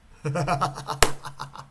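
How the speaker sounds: loud burst of laughter. Retold or imitated. Parent joking about woods eating them, loud laughing. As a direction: laugh out loud in a rapid run. Laughs after own spooky line.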